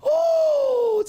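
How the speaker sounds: high exclaimed oh; surprised oh. Real, not acted. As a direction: gasp a high oh. Reacting to chat.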